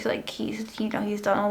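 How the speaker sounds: whispering. Real, not acted. Aside about the surgeon.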